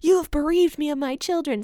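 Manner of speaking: mild mock yell at his sons; mock scream. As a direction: cry out in retold panic, not full force. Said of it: "he screams at his kids".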